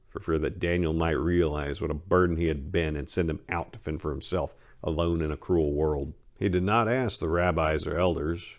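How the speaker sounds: ordinary delivery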